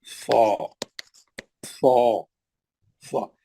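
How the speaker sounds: सो low, held on a rounded "aw"; thick. Shown as a demonstration. Teacher drills the heavy letter with students, thick and low. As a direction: round the mouth and make सो heavy. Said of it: "ये कैसा पढ़ेंगे आप".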